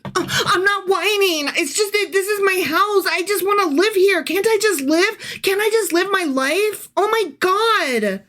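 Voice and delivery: high, whining, moaning voice; high nasal-free whine of complaint; whiny bitching. Acted. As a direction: whine it like a wronged man. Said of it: "if I was bitching and moaning".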